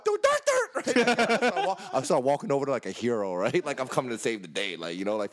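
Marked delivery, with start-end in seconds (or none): 0.0-1.0 much higher, cartoon squeak; high squeaky sound effect. Teller voices his walk to her door as a squeak. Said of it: "I get out my car".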